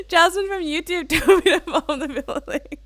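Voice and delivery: high, loud, giggling through the quote; laughing quote. Retold or imitated. Streamer imagining a viewer's confession, laughing hard. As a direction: imitate someone, cracking up. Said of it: "on scene and saying".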